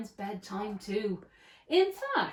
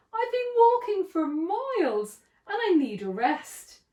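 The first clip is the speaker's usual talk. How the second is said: moaning; 8 semitones higher, 3 dB louder than usual. A narrator's reading. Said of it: "he moaned".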